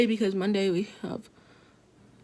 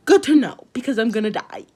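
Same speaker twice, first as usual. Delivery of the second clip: mock-alarmed sarcasm; 7 dB louder than usual. To her friend about the girl waving, loud mock alarm. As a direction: throw it out like a dramatic joke.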